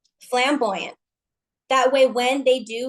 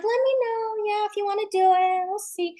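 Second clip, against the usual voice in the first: half-hearted invite; 7 semitones higher than usual. Retold or imitated. Recruiter brushing off a prospect, half-hearted. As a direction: shrug it off like you don't care. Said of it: "and then saying".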